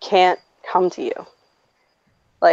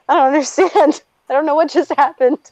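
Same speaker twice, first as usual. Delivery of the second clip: high-pitched, bewildered kid's wail, lightly acted; mock childhood crying; 6 semitones higher than usual. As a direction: imitate a crying, confused six-year-old. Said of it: "six years old and I'm crying".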